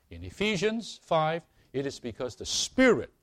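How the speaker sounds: fervent high preaching. Acted. Preaching at full force.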